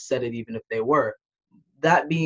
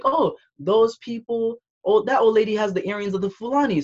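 higher-pitched retold gasp of realization; excited recognition; 8 semitones higher, 3 dB louder than usual. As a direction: recount spotting it with excited recognition. Retells own excited recognition in cinema.

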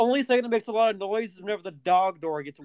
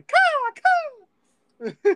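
shrill screeching bird woman. Pretend. Mock bird call after laughing.

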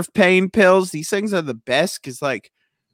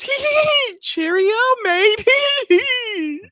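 very high, giggling mock-British voice; demonic British giggler. Re-enacting the demon that turned British, high giggly British voice. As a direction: squeal it in British between demented giggles. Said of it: "It turned British".

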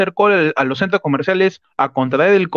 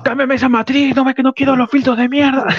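high-pitched mock yell of orders; mock shouting; 7 semitones higher than usual. Pechi imitated yelling at the technician, mock shout. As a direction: bark it like an angry host at the crew. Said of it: "Eso grita".

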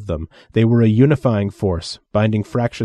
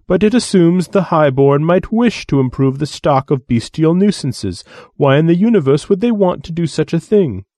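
lofty, repulsed alien voice; disgusted alien envoy; 7 semitones higher, 5 dB louder than usual. Acted. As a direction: recoil in haughty disgust.

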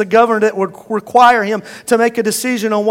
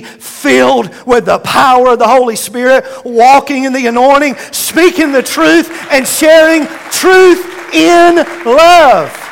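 building preaching; 3 semitones higher, 5 dB louder than usual. Acted. Preacher lifting the church to full force shout.

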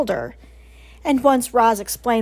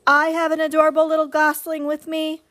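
higher, even-toned robot; friendly booming robot; 5 semitones higher, faster than usual. A narrator's reading. Robot introducing the gosling to the goose, friendly boom. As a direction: announce it amiably across water. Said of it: "the robot's friendly voice boomed over the water".

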